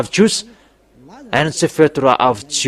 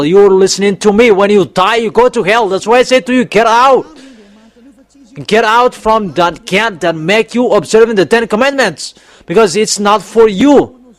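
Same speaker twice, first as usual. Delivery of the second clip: fiery preaching; 5 semitones higher than usual. Acted. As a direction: preach it at full force, hammer them. Preacher warns of hell.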